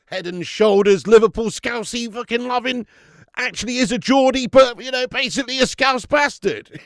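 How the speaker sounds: loud banter rant; excited rant. Real, not acted. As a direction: rile up the Liverpool fan. Ranting about Henderson.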